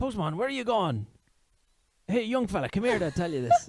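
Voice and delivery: loud, high Southern Irish calling out; Southern Irish accent, shouted. Acted. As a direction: hail the postman in broad Southern Irish. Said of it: "I went full Southern Irish now".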